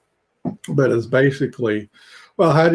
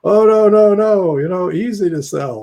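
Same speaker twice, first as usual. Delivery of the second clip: higher, lightly imitated Israeli accent, waving off doubts; a hint of an Israeli accent, breezy and sure; Edna's Israeli accent; 8 semitones higher, 4 dB louder than usual. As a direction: brush it off in a mock Israeli accent. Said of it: "in her very Israeli accent".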